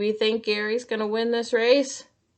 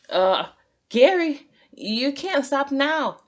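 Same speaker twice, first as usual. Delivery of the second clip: worried; 7 semitones higher, 4 dB louder than usual. A narrator's reading. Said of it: "said SpongeBob".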